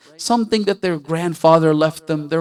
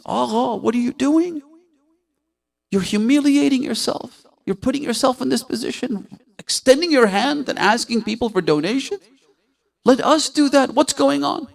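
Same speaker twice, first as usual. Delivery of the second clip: shocked protest; 9 semitones higher than usual. Retold or imitated. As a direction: voice alarmed students protesting to their teacher. Students protest to their teacher.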